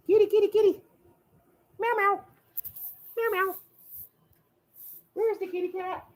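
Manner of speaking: very high sing-song pet voice; calling the cat. Real, not acted. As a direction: coo at the cat in a squeaky voice.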